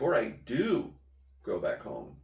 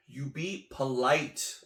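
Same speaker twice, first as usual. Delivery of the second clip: normal talking voice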